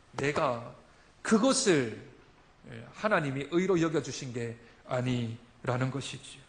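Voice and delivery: ordinary talk, nothing marked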